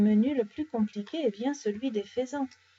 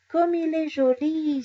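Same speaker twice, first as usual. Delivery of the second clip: delighted exclamation; 6 semitones higher, 4 dB louder than usual. A narrator's reading. Oscar sees the newborn lamb, exclaims in delight. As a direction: coo it high with delight. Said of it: "s'exclame Oscar".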